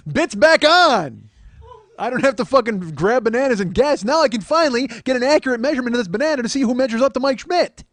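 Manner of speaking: high, loud celebratory shouting; all excited. Retold or imitated. Man celebrates finding the tape measure, excited shout. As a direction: shout it like the plan is saved. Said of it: "Like, I'm all excited".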